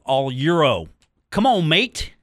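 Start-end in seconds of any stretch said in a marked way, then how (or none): 1.3-2.1 high, loud Come on, mate in a fake British accent; mock British accent. Host gamely tries a British accent on air. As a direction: try a British accent on mate, not quite landing. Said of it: "I have to have an accent to do that".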